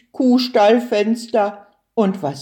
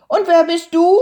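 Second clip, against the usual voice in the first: high, louder questioning turtle voice; curious little turtle; 9 semitones higher, 6 dB louder, much faster than usual. A narrator's reading.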